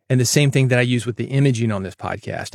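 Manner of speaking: ordinary talk, nothing marked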